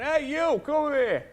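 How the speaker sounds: a touch of a gruff club regular; mock bloke calling. Club bloke calling the young comic over, lightly mocked. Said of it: "this bloke sort of called me over and went".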